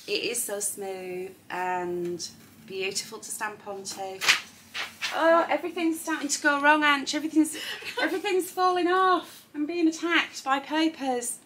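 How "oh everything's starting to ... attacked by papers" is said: high, loud and fast, laughing panic; flustered excitement. Real, not acted. Presenter to Ange as papers fall, flustered high squeal. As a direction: squeal as everything falls apart. Papers falling during live show.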